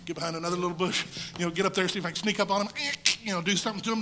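quick, scheming mutter; a timid fighter plotting to creep up; sneaky rushing. Retold or imitated. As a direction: rattle off a sneaky plan fast. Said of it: "He went like".

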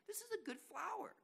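very high, soft gushing approval; hammed-up high-pitched enthusiasm; mock excitement. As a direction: imitate someone delighted by a flower. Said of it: "excited about it as some people".

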